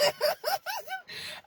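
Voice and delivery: high-pitched helpless laughter; cracking-up laugh. Real, not acted. Remembering hilarious gaming videos, cracking-up laugh. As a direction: laugh until breathless.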